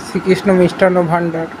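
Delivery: ordinary talk, nothing marked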